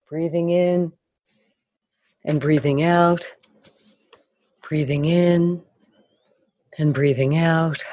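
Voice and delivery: calm, soothing breathing guide; guided meditation. Acted.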